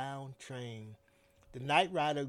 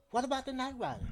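worried; 10 semitones higher, 3 dB louder, much faster than usual. A narrator's reading. Worried question.

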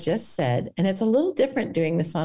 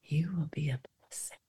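barely audible, softer whisper; faint whisper; 4 semitones lower, 11 dB quieter than usual. Pretend. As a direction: breathe it out almost silently. Guided meditation, repeated softer.

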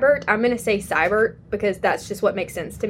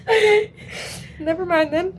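laughing; 9 semitones higher than usual. Real, not acted. Still laughing after "queen.

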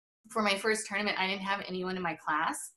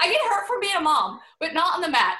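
emphatic; 6 semitones higher, 7 dB louder than usual. Real, not acted. Emphatic laughing insistence.

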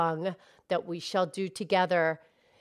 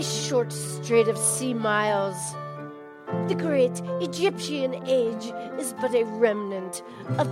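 put-on Scottish accent recitation; 5 semitones lower, 4 dB quieter, faster than usual. Cow recites [name]'s Atlantis in a mild Scottish accent. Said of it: "You do it again, please".

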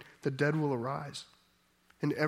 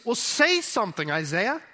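higher, louder mock-impatient urging; impatient prodding; 5 semitones higher, 5 dB louder than usual. Preacher voices the voice prompting Isaiah, impatient prodding. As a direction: prod a tongue-tied prophet to speak up.

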